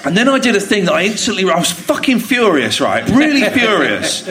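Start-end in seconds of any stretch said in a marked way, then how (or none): none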